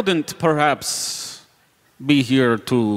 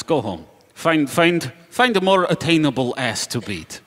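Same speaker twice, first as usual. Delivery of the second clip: ordinary talk, nothing marked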